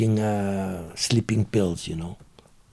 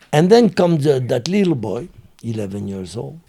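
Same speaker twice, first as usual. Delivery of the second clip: usual speaking voice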